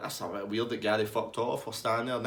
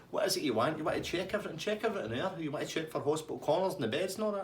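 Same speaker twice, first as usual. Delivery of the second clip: high-pitched mock cheek; cheeky; 6 semitones higher, faster than usual. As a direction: needle the police in a put-on high voice. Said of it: "you need to stop being cheeky".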